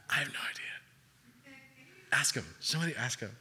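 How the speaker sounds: hushed disciple whisper. Retold or imitated. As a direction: lean in and whisper. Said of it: "they're all whispering".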